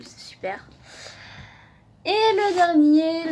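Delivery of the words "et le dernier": slow, loud, high build-up; drawn-out showman's announcement; dramatic reveal. Real, not acted. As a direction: announce it like a big unveiling. Dramatic reveal of last manga.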